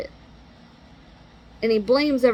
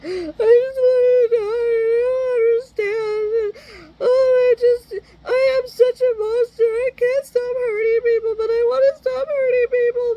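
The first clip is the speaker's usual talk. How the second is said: hammed-up crying whine, much higher; mock whining sob; 13 semitones higher, 4 dB louder than usual. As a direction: mimic a wailing, self-pitying teen. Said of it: "he whined".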